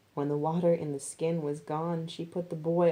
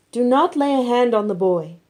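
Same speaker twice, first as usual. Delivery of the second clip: high, loud, commanding angel voice; urgent angel command; 6 semitones higher, 10 dB louder than usual. A narrator's reading. Angel forbids Abraham to harm the boy, loud and high. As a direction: halt him with force.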